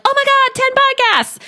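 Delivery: very high, giddy retold squeal; beyond excited. Coach reliving her first ten downloads, hammed-up excitement. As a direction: replay giddy excitement, pitched right up. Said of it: "I was beyond excited".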